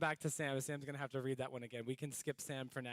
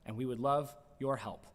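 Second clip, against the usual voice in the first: news read; 3 semitones higher, 9 dB louder than usual. Acted. News segment announcement.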